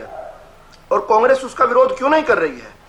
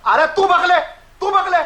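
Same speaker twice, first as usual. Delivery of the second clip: higher, contemptuous yell; angry shout; 6 semitones higher than usual. Real, not acted. Panelist angrily dismissing heckler. Heated debate quarrel.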